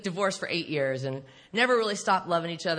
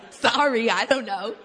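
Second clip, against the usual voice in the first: giggling through sorry, I don't know; laughing apology; 3 semitones higher, 8 dB louder than usual. Real, not acted. Embarrassed by her own joke to the audience, laughing apology. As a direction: let the laugh take over.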